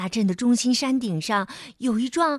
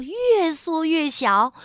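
plain reading voice

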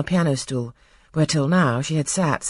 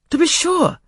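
high, eager agreement; a brother eagerly backing Frederick, high-pitched; eager young man; 6 dB louder than usual. A narrator's reading. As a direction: chime in keenly. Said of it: "rejoined his brother".

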